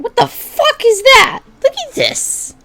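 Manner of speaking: high squealing disbelief; shocked shriek. Real, not acted. Vlogger shrieking at an old fan fiction site, high and loud. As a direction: shriek at the cluttered website.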